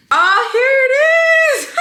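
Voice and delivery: high, loud squeal of triumph; excited discovery. Real, not acted. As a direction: burst out on finding it. Finds the entry at last.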